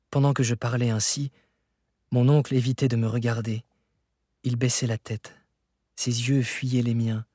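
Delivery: plain reading voice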